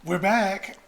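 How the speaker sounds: high, loud, playful "we're back"; excited announcement. Real, not acted. Co-host breaking the banter to greet listeners, excited announcement. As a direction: announce the comeback with a laugh in it.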